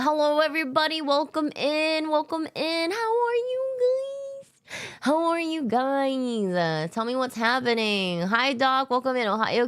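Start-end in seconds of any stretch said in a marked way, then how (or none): 0.0-2.9 put-on high voice held on a level note; high-pitched greeting. Streamer welcoming chat, silly high greeting. 2.9-4.8 very high, slow, stretched squeal; squealed greeting. Pretend. Streamer greeting chat, drawn-out silly squeal. 5.0-6.5 slow, higher-pitched, sliding down at the end; drawn-out greeting. Pretend. Streamer asking chat how they are, drawn-out greeting.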